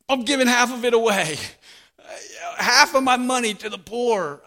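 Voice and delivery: giving everything away in pure delight; giddy Zacchaeus. Acted. Zacchaeus stands up before the crowd, giddy and elated.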